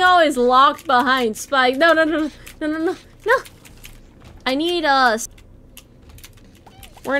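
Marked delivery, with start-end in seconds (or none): none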